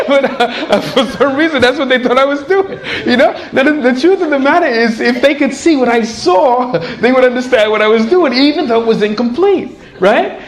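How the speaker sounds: ordinary delivery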